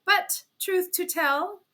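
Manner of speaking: lightly voiced fiancé's letter, tearful. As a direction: read the letter as if it wounds her. Reading the painful letter.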